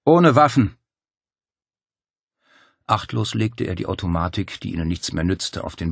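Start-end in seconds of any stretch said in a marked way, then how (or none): 0.0-0.8 loud, much higher call; shouted reply. Acted. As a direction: call out to the men. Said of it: "rief er".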